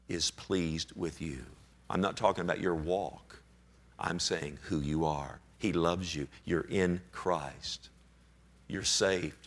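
low, soft, unhurried assurance; tender reassurance. Real, not acted. Preacher tells believers they are saved and secure, tender reassurance. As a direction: let each phrase settle gently, with pauses.

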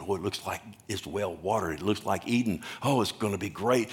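hushed, low-key wonder, as if to himself; quiet musing. Acted. Preacher plays Lot eyeing the well-watered plain, quiet musing. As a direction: softly voice Lot admiring the land.

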